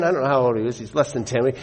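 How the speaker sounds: normal talking voice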